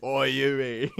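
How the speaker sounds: hammed-up British-Aussie accent on a greeting; bad Butcher accent. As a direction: do a badly faked Cockney-Aussie accent. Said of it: "I can't do the accent".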